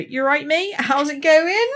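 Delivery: high-pitched, hammed-up Somerset greeting; broad West Country matey hello; Somerset accent. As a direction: put on a farmer-ish Somerset lilt. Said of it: "if I come into a lecture".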